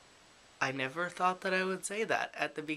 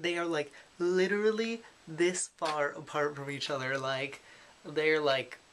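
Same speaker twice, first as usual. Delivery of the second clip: ordinary talk, nothing marked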